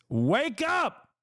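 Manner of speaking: high, hammed-up yell; mock wake-up yell. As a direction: shout it like a crazed truther. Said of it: "I'm being sarcastic".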